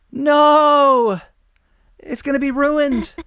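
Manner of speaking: high, loud despairing cry; hammed-up dramatic wail; wailing shout. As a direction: shout it like it's all ruined. Character wails over ruined moment.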